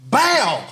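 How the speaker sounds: loud high bow for a slap; mock slap sound; smack sound effect. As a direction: punch out the slap noise.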